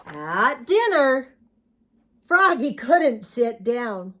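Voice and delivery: ordinary delivery, nothing marked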